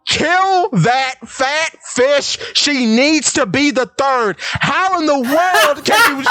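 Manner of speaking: high, whiny, exasperated complaint; exasperated rant. Real, not acted. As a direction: rant at wit's end, voice cracking. Ranting exasperated about fish man.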